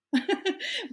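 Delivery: short bright burst of laughter; embarrassed giggle; light laugh. Real, not acted. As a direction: laugh it off a little. Laughing about partial secrets.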